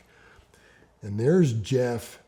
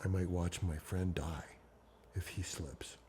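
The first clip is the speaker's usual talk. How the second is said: hushed inner thought; 5 semitones lower, 17 dB quieter than usual. Retold or imitated. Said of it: "I'm going".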